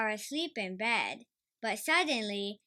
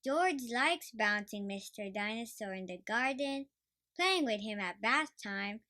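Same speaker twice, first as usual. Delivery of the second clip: plain reading voice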